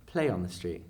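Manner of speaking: normal talking voice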